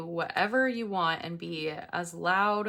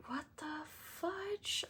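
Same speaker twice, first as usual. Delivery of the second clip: mock disbelief; 8 semitones higher, 12 dB quieter, slower than usual. Outraged at the noise.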